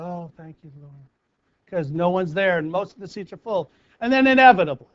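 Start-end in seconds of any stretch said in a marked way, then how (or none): 0.0-0.9 soft, much quieter sigh of relief; a murmured little prayer of thanks; relieved thanks. Retold or imitated. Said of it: "finally sit down and go".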